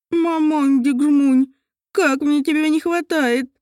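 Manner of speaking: slow, slurred wail. Acted. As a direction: wail through a swollen tongue. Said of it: "завыла эльфийка".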